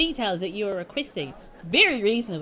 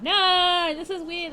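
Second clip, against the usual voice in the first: normal talking voice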